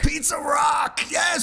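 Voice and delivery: high-pitched, thrilled cheer; excited shout. Real, not acted. Co-host cheering a beloved pizza place, excited shout.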